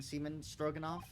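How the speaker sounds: ordinary delivery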